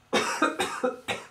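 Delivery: rough throaty cough; real cough. Real, not acted. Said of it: "that was really me coughing".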